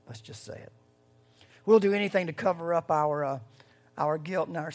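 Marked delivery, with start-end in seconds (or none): 0.1-0.7 whispered, barely audible. Shown as a demonstration. Preacher whispering the confession to the church. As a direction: breathe it out as a secret.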